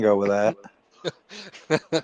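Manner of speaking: slow, through laughter; laughing. Real, not acted. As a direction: say it while cracking up.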